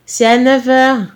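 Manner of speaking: trying to sound sure of yourself; assured voice. A narrator's reading. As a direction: answer as assuredly as you can. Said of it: "la voix la plus assurée possible".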